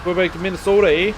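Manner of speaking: mock Minnesotan with a stretched "Minnesota"; Minnesotan accent. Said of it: "there's like a Minnesotan accent".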